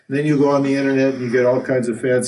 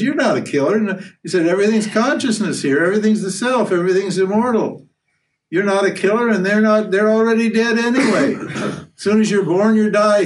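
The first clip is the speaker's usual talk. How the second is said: higher, emphatic teacher's voice; Krishna's raised, insistent teaching; Krishna explaining; 8 semitones higher than usual. Retold or imitated. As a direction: lecture the pupil firmly. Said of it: "Krishna just says".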